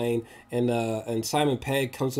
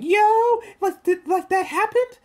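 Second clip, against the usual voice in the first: much higher, louder stunned yelling; replayed disbelief; 6 dB louder than usual. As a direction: relive the jaw-drop moment, loud and high. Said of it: "I was like".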